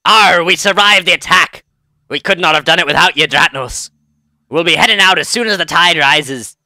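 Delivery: hammy pirate captain reading out thanks. Acted. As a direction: play the pirate captain, opening on a big arr. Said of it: "I should actually be doing a pirate voice".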